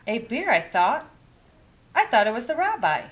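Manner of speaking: high, quick amazed voice; astonished granny. Acted. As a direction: realise it was a bear all along. Baba astonished.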